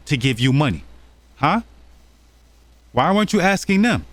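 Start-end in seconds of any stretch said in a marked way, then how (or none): 1.4-4.1 pin her down with the question, voice up; pointed challenge. Real, not acted. Commentator confronting the scammer.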